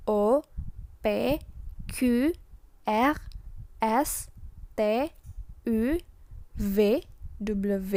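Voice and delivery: each letter "A" to "Z" separately with its French name; French alphabet recital. Shown as a demonstration. Teacher reciting A to Z for students, French letter names. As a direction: recite "A, B, C" as "a, bé, sé", pausing after each. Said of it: "akan seperti ini".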